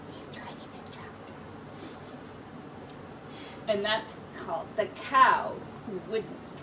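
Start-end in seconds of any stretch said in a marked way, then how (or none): 0.1-1.3 breathy stage whisper of the twist; whispered reveal to listening children. Acted. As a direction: let the reveal slip out in a whisper. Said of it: "reader whispers the reveal".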